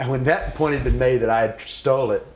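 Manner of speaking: normal talking voice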